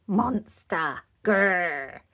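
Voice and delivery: put-on loud toddler growl; playful roaring word, then grrr; little monster. A narrator's reading. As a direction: growl like a small child playing monster. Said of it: "said George".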